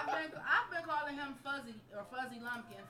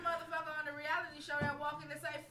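ordinary talk

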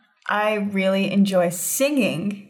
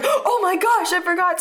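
panicked; 10 semitones higher than usual. Retold or imitated. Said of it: "And I was like".

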